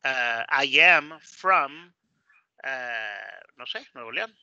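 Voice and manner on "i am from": "I am from" drawn out slowly and louder; teacher stretching "I am from" for the class; slow emphatic demo. Shown as a demonstration. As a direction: spell out "I am from" word by word. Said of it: "pues diría".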